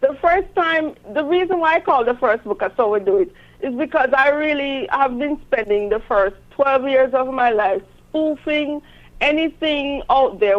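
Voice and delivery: ordinary talk, nothing marked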